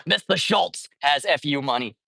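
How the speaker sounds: a hint of a fiery old senator grilling a CEO; mock Bernie grilling a billionaire; Bernie Sanders voice. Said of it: "let me put on my Bernie Sanders voice".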